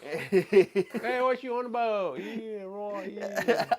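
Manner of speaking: high, hammed-up Australian heckle; Australian accent. Voicing the one left in the dust, mock Australian accent. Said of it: "said with an Australian accent".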